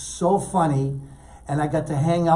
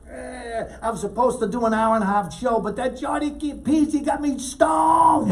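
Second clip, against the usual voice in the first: much higher, louder shouted rant, slowing at the end; Kinison stage shout; 7 semitones higher, 6 dB louder than usual. Retold or imitated. Kinison telling the crowd Johnny got him stoned, shouted. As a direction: bellow it like a comic blaming a friend onstage.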